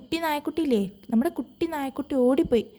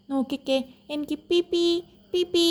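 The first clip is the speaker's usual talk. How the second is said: higher squeaky peep peep; a tiny squeak to prove it; mouse squeak; 4 semitones higher than usual. Retold or imitated. Mouse proves to the puppy it only squeaks. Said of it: "എനിക്കിങ്ങനെ പറയാൻ അറിയൂ".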